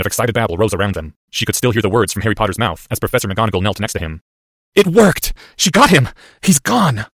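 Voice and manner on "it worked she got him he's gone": high, raised exclamations; urgent elation. Acted. Boy announces the enemy is gone, urgent elation. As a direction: cry out the news in short bursts. Boy reporting victory after collapse.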